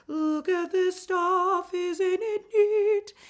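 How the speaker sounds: high, slow line pushed forward in the mask. Shown as a demonstration. Teacher shows students the forward mixed placement. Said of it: "It's going to be forward".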